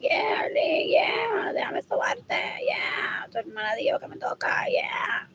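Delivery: unvoiced, breathy mock of a creep; creepy whisper. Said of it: "He's like".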